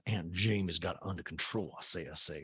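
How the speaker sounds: comic Southern matron voice; Southern drawl. Retold or imitated. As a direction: do an old Southern belle saying "I say, I say". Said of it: "I like that it says".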